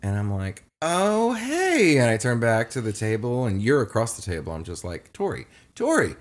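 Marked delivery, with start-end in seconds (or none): 0.8-2.0 very high, drawn-out hello; mock-casual greeting. Greeting his ex on turning around, mock-casual high voice. 5.1-6.2 trying to get attention. Retold or imitated. Calling a friend across the table, trying to get attention. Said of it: "I'm trying to get your attention".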